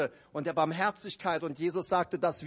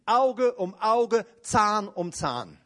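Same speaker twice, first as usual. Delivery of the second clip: slow, heavy words spaced apart; preacher hammers out the law of revenge slowly; slow emphatic; 3 semitones higher, 5 dB louder, much slower than usual. Real, not acted. Prediger betont langsam und schwer.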